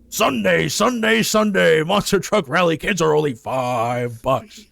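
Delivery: booming high announcer hype; monster truck ad. Pretend. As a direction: shout it like a rally commercial. Parody monster truck radio ad.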